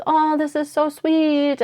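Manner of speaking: mock-swoon over a lovely character; gushing. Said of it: "and you're like".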